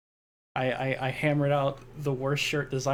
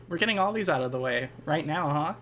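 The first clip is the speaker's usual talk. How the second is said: ordinary talk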